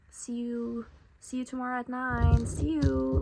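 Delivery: lightly mimicked chatty farewell, lifting in pitch; imitating friendly goodbyes. Imitating classmates making plans together, mock-friendly farewells.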